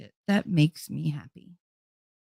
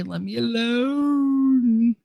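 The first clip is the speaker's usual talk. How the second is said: long, drawn-out, higher plea; playful whine; 6 semitones higher, much slower than usual. Real, not acted. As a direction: stretch the last word out in protest. Playfully begs off, drawing it out.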